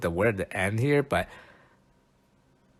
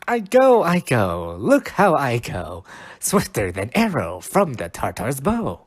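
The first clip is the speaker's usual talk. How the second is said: dramatic Shakespeare reading; 2 semitones higher than usual. Acted. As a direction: launch it like Puck setting off. Reading Puck's lines aloud.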